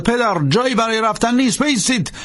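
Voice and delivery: high, urgent shout to the priest; shouted plea. A narrator's reading. Langdon yelling after the running priest, high urgent shout. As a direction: yell at him to stop. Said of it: "فریاد زد".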